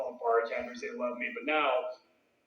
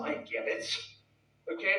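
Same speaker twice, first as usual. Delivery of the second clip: ordinary talk, nothing marked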